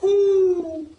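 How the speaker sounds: high, very slow chanted hoots; hooting owl. Acted. Owl sends them to the crazy bird, chanting. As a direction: intone it on long owl-like notes. Owl's drawn-out hooting answer.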